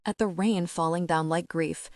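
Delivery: usual speaking voice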